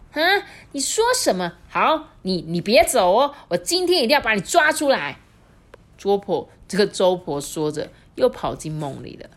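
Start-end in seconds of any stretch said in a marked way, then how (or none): 0.1-5.2 high, loud and fast threat; old wife threatening in a fury; enraged old wife. Acted. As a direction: vow to drag him out. Old woman vows to catch him.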